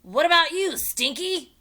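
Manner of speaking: high loud pirate bellow; captain roaring to his crewman. A narrator's reading. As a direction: roar the question up. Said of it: "Captain Bones roared".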